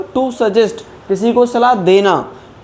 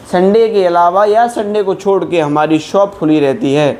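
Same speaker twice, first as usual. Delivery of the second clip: ordinary talk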